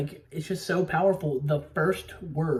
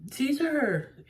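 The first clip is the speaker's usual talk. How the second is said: calling out a name; 9 semitones higher than usual. Retold or imitated. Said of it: "James Franco shows up and is like".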